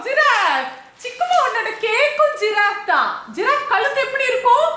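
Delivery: very high singsong parent voice; high-pitched, teasing questions to a little one. Real, not acted. Parent playing animals with toddler.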